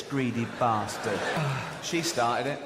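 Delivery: usual speaking voice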